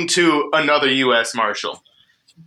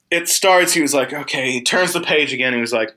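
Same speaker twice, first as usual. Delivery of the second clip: ordinary delivery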